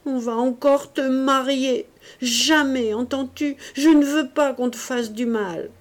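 an overwrought brother's angry cry; dark rage, stammering. A narrator's reading. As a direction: blurt out his fear in a dark rage. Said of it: "agité d'une rage sombre, bégayant".

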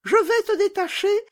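very high squeaky little bandit; gleefully cruel robber girl. Acted. Robber girl releasing her reindeer, very high and mocking. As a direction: tease and boast in a piercing child voice.

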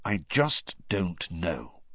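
slow, doubtful repeat; slowly. Acted. Sirius admitting to Harry he is unsure, slowly. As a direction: let it hang, drawn out. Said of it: "said Sirius slowly".

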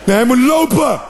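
exasperated shout. Retold or imitated. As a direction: overrule her with a shout. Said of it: "answering the wife". (geërgerde uitroep nee hij moet lopen)

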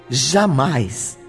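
normal talking voice

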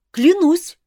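high, loud eager oath; eager vow. A narrator's reading. Prince eager to hear the secret, keen loud vow. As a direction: jump at the chance to swear. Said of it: "с готовностью откликнулся Мафей".

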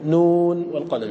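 نون drawn out slowly on one held note; recited verse opening. Shown as a demonstration. Teacher quoting the surah's opening for students, recited. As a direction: stretch نون long and level before والقلم. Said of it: "إذا قلت".

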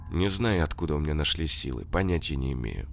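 usual speaking voice

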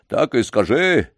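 put-on old man calling the dog and the boy to leave. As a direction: end the talk loudly. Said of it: "возвысил голос дедушка".

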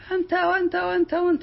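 squealing pitch-high mockery of a yeller; mock shouting. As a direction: mimic someone with no argument, shrill. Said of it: "اللي ما عنده حجة يصيح". (تقليد ساخر لمن يصيح بلا حجة)

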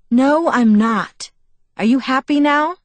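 loud, high, grudging surrender; exasperated. A narrator's reading. Bella grudgingly gives in to Edward, exasperated. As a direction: give in loudly, then rise into a testy question.

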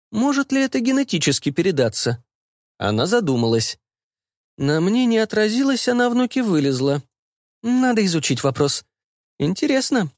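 high-pitched mother, musing; pondering mother. Acted. Mother wondering whether it runs in the family, pondering. Voices the mother thinking aloud.

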